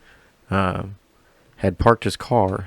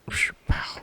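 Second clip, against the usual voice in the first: gunshot sound effect; 7 dB quieter than usual. Retold or imitated. Imitating a gunshot.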